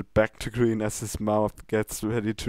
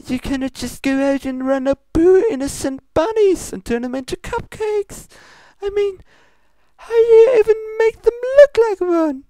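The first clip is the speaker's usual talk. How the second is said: high, loud, cracking outrage; Luna outraged; 9 dB louder than usual. Acted. Luna scolding Pinkie over the bunnies, voice cracking high and loud. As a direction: let the indignation break the voice up high. Luna's outburst, voice breaks.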